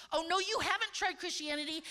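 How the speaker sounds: ordinary talk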